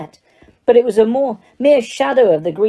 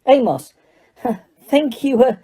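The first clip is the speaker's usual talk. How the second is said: higher-pitched, mildly angry warning; 6 semitones higher than usual. A narrator's reading. Weasley warns Amos about Harry, angry. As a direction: pull a colleague up angrily. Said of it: "said Mister Weasley very angrily".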